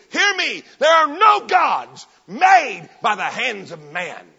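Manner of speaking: loud, slow, commanding shout; anointed authority. Retold or imitated. Paul addressing all the cities, shouting with authority. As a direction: thunder it with apostolic authority. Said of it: "there come a special anointing of authority".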